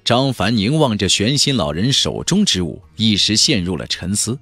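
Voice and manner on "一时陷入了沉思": usual speaking voice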